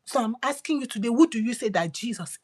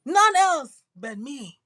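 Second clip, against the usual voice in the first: slow proclamation; 3 semitones higher, 3 dB louder, slower than usual. Acted. God's words, slowed.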